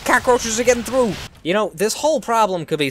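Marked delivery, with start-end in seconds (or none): 0.1-1.1 high, loud warning cry; raised-voice game alarm; alarmed shout. Real, not acted. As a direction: call out the breach in alarm. Alarm at enemies breaking through.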